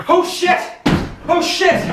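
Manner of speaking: mock panic. Realizing he is stuck hanging.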